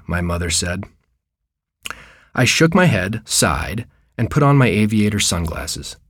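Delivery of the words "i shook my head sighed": ordinary delivery, nothing marked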